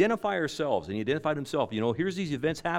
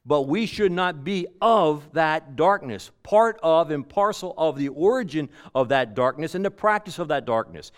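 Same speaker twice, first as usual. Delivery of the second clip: full-force preaching; 4 semitones higher, 6 dB louder than usual. Real, not acted. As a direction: hammer the point home.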